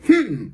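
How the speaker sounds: put-on high, loud sulky hmm like a kid; childish pouty hmm. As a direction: pout out a whiny hmm. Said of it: "she'd go".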